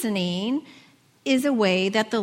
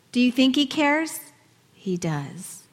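ordinary talk

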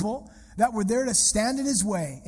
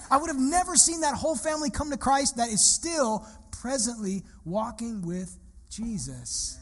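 normal talking voice

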